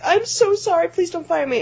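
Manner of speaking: high-pitched frightened begging; squeaky mock pleading; pleading apology. Clerk begs manager to keep job, high anxious plea. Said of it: "And I'm like".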